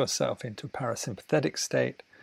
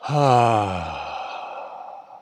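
long deep falling sigh of release; 4 semitones lower than usual. Shown as a demonstration. Teacher leading the class in a big sigh. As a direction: let it all drop out on the breath. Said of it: "Breathe in".

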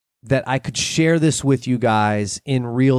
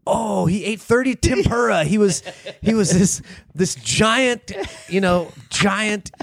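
mock awe; 8 semitones higher than usual. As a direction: marvel at a legendary giant, stretching the big words. Voicing awed locals recalling Chaplin.

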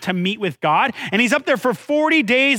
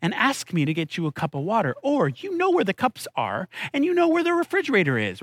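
softer, measured parental coaxing; patient parent; 5 dB quieter than usual. Retold or imitated. Parent answering whining kids about getting water, softly coaxing. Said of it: "I go".

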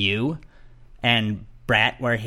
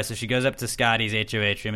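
usual speaking voice

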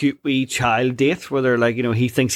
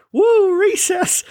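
very high slow whoop; giddy cheer; 4 dB louder, much slower than usual. Retold or imitated. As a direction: whoop like a kid let out to play. Homer cheering recess.

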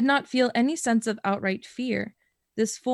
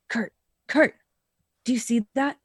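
normal talking voice

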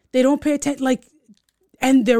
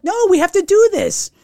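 higher, urgent retold plea; insistent; 9 semitones higher, 4 dB louder than usual. Aunt urging panicking niece to push, insistent. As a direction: overrule her, pleading upward.

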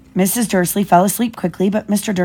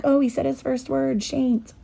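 higher, softer proud-mum voice; cooing new mother; 6 semitones higher, 8 dB quieter than usual. Retold or imitated. Mom coos over her baby's first word, gently imitated. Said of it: "and so she's like".

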